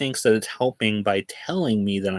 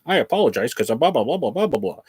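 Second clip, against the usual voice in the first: mock AI apology; faster than usual. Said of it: "from an A I saying".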